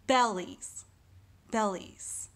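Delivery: bellies with the stress on "bel"; stress on first syllable. Shown as a demonstration. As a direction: say "bellies" leaning on "bel", "lies" falling away. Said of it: "more of an emphasis on bell".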